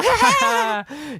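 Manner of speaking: very high, loud "ha"; imitated triumphant cackle. Reenacting the gunfight victory, high whooping cackle. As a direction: let out a piercing mock whoop.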